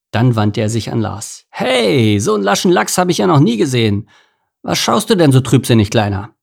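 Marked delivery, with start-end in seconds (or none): 1.5-6.3 high, jaunty teasing eel; cheerful eel, pitched up; cheeky eel Anton. Acted. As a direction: greet your old friend with a grin.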